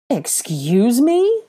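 slow, loud, steeply rising demand; high, incredulous outrage; outraged. A narrator's reading. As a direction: stretch it out in affronted disbelief. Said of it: "he demanded in outrage".

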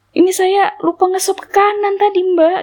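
high-pitched sheepish explanation; flustered admission. Retold or imitated. Driver explaining the missed swipe to passenger, high and flustered. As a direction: imitate the dismayed driver.

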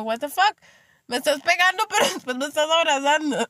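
high, incredulous outburst breaking into laughter; mock outrage at mixed signals; baffled protest. Child to mother who hits then hugs, mock-baffled protest.

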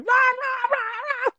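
high-pitched imitation dog yelps; yelping dog. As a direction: yelp like a hurt little dog. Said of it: "like, yelping like I've hurt her".

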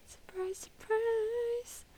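very high sing-song squeak; exaggerated mock surprise. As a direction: push it right up high and hold the last note. Topping the mock surprise game.

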